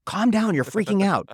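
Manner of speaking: loud, high, slow scolding; mock dismissive snap. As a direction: bark it like the worst thing to say. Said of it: "Worst thing you could say".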